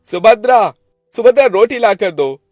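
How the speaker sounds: high, loud shout to another room; loud commanding call. Acted. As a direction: holler impatiently for service. Brother calls out for food.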